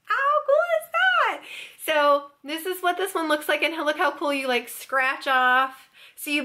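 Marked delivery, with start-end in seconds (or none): 0.1-1.4 high, thrilled question; showing off the gift card holders, pitched way up; excited. Real, not acted. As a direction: gush over something cool. Gushing over gift card holders.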